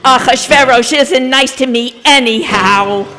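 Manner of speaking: plain reading voice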